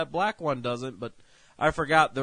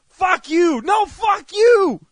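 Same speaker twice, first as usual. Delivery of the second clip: high, loud hammed-up screaming; screamed quarrel; 12 dB louder, much slower than usual. Caller and shopper ending their soup quarrel, screamed acted fury. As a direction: trade insults at full volume.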